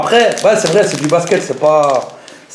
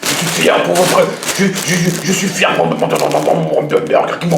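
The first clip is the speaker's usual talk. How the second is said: slow, slurred, stammering drool; drooling from hunger; slower than usual. Retold or imitated. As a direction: imitate a guy too hungry to talk. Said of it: "il arrivait même plus à parler tellement il avait faim, tellement il bavait".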